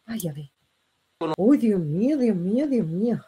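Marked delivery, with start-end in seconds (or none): none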